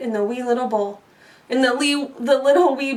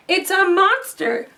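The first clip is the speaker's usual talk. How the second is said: moaned; 7 semitones higher than usual. Acted. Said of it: "moaned Mama Bear".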